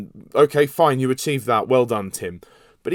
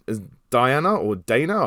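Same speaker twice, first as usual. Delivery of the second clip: normal talking voice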